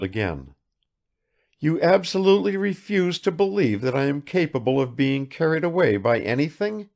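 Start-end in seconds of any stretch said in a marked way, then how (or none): 1.6-6.9 high, lightly acted challenge; a hint of a challenging lady; lightly voiced Madame Odintsov, challenging. A narrator's reading. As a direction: question him in a lightly voiced lady's part.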